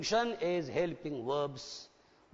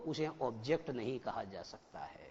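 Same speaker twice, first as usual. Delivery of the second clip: "उसे ऑब्जेक्ट नहीं कहा जा सकता है" said lower and softer, falling; quiet first statement; 7 semitones lower, 7 dB quieter than usual. Shown as a demonstration. Teacher to students, stating the rule quietly. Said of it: "repeated louder".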